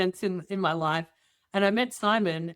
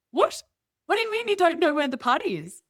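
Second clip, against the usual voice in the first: high, disbelieving question; incredulous; 11 semitones higher, 3 dB louder than usual. Retold or imitated. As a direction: press him, baffled and high-pitched. Disbelief at secret party location.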